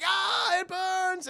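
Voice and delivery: high-pitched mock scream of pain; Morbius. Vampire hit by infrared lights, mock agony. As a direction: wail as if scorched, hammed-up. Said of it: "And Morbius is like".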